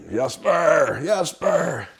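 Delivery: high, loud call of a name; yelling for son. Retold or imitated. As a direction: call your boy home from far off. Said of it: "I was yelling for".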